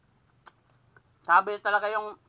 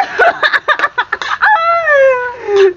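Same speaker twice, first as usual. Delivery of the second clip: high, breathless laughing fit; high laughter; 12 dB louder than usual. Real, not acted. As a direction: crack up laughing helplessly. Laughing at fallen frog.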